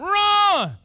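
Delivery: high loud yell; crowd yelling run. Retold or imitated. Parents yelling at runner, loud yell. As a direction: shout it like the whole crowd.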